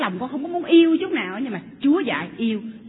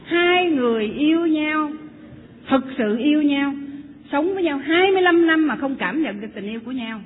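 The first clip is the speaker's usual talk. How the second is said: emphatic preaching; 2 semitones higher, 5 dB louder than usual. Acted. Preaching climax to congregation.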